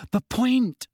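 high-pitched, insistent interruption; pressing on loudly. A narrator's reading. Hermione pressing on over Ron, insistent. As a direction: cut in, pitch jumping up. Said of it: "Hermione pressed on loudly".

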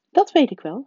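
a hint of the strict older witch; stern professor voice. A narrator's reading. McGonagall voiced by the narrator.

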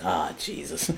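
low, soft resigned groan; deflated, under the breath; sighing Steve. Retold or imitated. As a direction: imitate a weary big sigh. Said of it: "He just gives a big sigh".

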